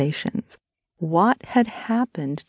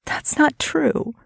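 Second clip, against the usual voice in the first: high, slow, wounded protest; whimpered denial; 10 semitones higher than usual. A narrator's reading. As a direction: whimper it slowly, pitched high. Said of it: "she whimpered".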